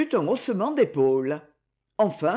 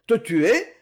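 plain reading voice

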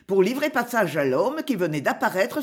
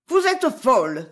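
higher, indignant outcry; raised-pitch storybook outburst; angry exclamation; 4 semitones higher, 4 dB louder than usual. A narrator's reading. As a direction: cry out in a burst of anger. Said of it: "s'écria-t-elle".

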